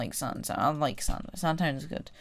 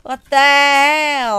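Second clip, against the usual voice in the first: very loud, high, drawn-out yell; long exasperated outburst; outraged shout; 7 semitones higher, 13 dB louder, slower than usual. Real, not acted. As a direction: yell it slowly in disbelief. Outburst after being killed.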